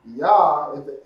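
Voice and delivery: ordinary talk, nothing marked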